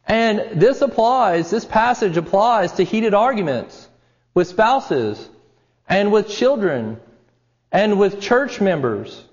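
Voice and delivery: impassioned preaching. Acted. Preacher builds urgency on the passage.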